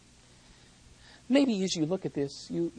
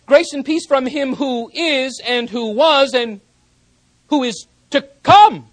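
higher, louder, declaring "who is to come"; ringing proclamation; 8 semitones higher, 15 dB louder than usual. Shown as a demonstration. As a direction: proclaim the true text with authority. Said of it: "Rather, he writes".

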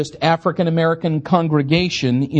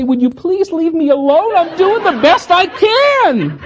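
frazzled plea; 9 semitones higher than usual. Retold or imitated. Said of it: "wanted to stop and say".

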